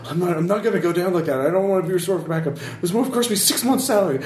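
backing away in fear; scared soldier. Acted.

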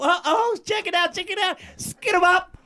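high, far-off hype shout; excited hype call. Real, not acted. Host hyping the young performer, excited high call. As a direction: hype up the crowd.

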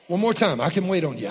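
usual speaking voice

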